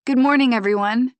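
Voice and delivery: called out high and loud to the group; calling out. A narrator's reading. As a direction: greet the whole dive group in a carrying call. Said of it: "I call".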